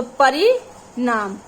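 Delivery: परिणाम drawn out slowly, each syllable separate; slow careful word. Shown as a demonstration. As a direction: stretch परिणाम slowly so the children hear it. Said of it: "लिखा है परिमाण". (परिणाम को धीरे-धीरे, हर अक्षर अलग बोलिए)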